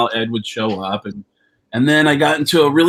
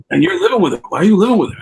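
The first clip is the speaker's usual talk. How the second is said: exasperated scold; 8 semitones higher, 6 dB louder, much faster than usual. Retold or imitated. Eddie scolding him on phone.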